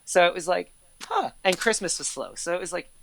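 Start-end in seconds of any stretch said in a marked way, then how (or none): 1.0-1.3 surprised huh. Retold or imitated. Mock reaction to slow season.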